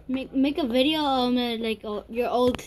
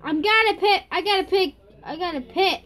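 high, loud gleeful announcement; excited; 6 semitones higher, 6 dB louder than usual. Real, not acted. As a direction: crow it over and over with delight. Thrilled at having a pet.

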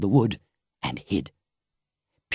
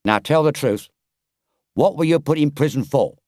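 stern; 3 semitones higher than usual. Acted. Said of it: "looked down upon him very sternly and said".

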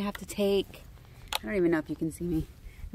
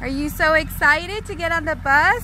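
slow, higher, louder cheerful singsong; excited; 6 semitones higher, 4 dB louder, slower than usual. Real, not acted. Mom hyping her daughter up for the school bus, excited.

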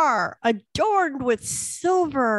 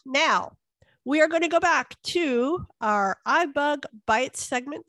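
usual speaking voice

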